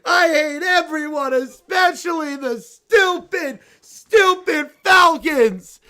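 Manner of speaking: shouting tantrum. Acted. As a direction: scream it at her like a kid refusing. Bear screams mom away.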